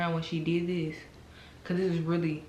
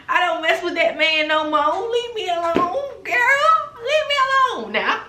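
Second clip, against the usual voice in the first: put-on high, loud old granny voice; 11 semitones higher, 10 dB louder, slower than usual. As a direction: squeal it out like your granny. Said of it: "Got me talking like my granny".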